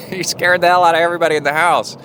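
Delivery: high, quick, tickled retelling; amused exclamation. Real, not acted. Father laughing about his son's night scare, amused exclamation. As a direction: rush it out high-pitched on the back of a laugh.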